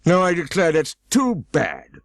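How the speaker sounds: high, loud indignant outburst; sudden passion. A narrator's reading. Humpty Dumpty accusing Alice of eavesdropping, high and loud. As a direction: accuse her hotly, voice rising. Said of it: "breaking into a sudden passion".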